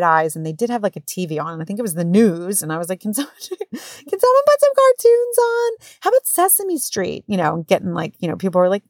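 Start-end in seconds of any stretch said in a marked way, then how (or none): 3.0-7.2 very high, mock-desperate plea; pleading mom. Mom begging ER staff for cartoons, high pleading voice. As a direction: squeak out the request, pitched way up.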